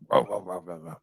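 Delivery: imitating a low rumbling voice; lightly voiced gravelly grunts; low gravelly groan. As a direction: mimic a gravelly old coach grunting. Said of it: "this low, gravelly voice".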